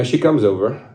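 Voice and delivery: ordinary talk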